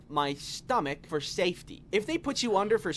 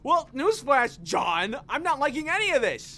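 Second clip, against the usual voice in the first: high, loud exasperated rant; sarcastic outrage, slowing on "not surgery"; mock outrage; indignant retort; 6 semitones higher, 3 dB louder than usual.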